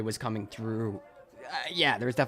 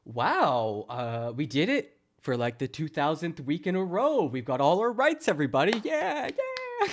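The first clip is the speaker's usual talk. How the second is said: mock celebration; 3 semitones higher than usual. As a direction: start matter-of-fact, climb into silly falsetto cheering. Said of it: "they're just like".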